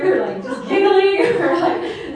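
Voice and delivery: high, loud laughing as she tells it; real laughter breaking in. Real, not acted. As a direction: giggle your way through it. Recalling a funny blessing story.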